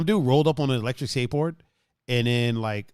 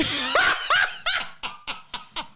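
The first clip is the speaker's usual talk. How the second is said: high burst of real laughter; real high laughter at the punchline. Real, not acted. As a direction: crack up hard, high pitched. Cracking up at own story.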